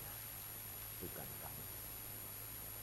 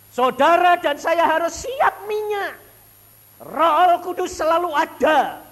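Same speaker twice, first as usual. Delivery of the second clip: loud, high preaching at full force; 19 dB louder than usual. Acted. Preacher proclaiming to the congregation, loud high preaching. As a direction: proclaim it to the church.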